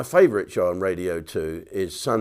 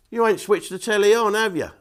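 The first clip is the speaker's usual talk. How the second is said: friend's reply; 9 semitones higher, 3 dB louder than usual. Acted. Comic voices friend's punchline reply.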